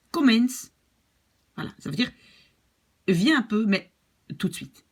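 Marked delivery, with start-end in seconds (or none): 0.1-0.7 quick, pressing call to come right now; teacher demoing an urgent come-here; urgent command. Shown as a demonstration. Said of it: "Ça, c'est tout de suite".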